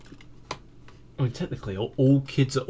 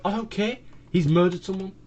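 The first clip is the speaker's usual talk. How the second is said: much higher, mock outraged reply; indignant retort; 8 semitones higher than usual. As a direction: snap back in disbelief, higher. Arguing against the cops' excuse.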